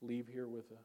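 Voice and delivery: soft, hushed prayer voice. Real, not acted. Preacher praying with the congregation before the sermon, soft prayer. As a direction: pray quietly and earnestly.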